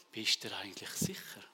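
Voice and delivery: hushed inner voice of doubt; doubting whisper. Retold or imitated. Preacher voicing his inner doubt in a whisper.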